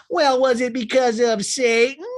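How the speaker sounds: high, loud, slow sketch-comedy voice; Church Lady. Retold or imitated. Church lady insinuating devilry, loud exaggerated impression. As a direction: draw it out with high-pitched mock suspicion. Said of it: "the church lady on Saturday Night Live with Dana Carvey".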